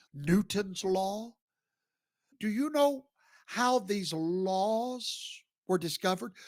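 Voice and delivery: ordinary talk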